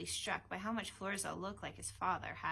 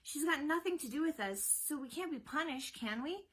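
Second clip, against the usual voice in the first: high, voiced, urgent persuasion; acted urgent son, pitched up; urgent whispering; 7 semitones higher, 4 dB louder than usual. A narrator's reading. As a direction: urge your father anxiously. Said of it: "whispering to his father urgently".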